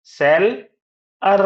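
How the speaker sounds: सेल and अर as two punched beats; split into syllables. Shown as a demonstration. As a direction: pause firmly between सेल and अर. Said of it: "seller कैसे बनता है?".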